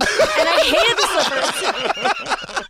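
high, slow laughing complaint; squealing laugh over hated slippers. Real, not acted. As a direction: laugh through the words. Retelling hated gift, laughing.

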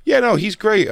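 retold fake-nice reply; mock polite self. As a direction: play it innocent and polite. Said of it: "I go".

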